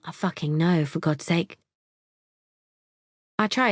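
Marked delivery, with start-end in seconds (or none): none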